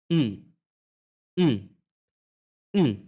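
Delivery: "उम" hummed with lips closed, mouth not opening. Shown as a demonstration. Teacher modelling "उम" as a lips-closed hum. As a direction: hum "उम" through shut lips. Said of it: "Look at my lips".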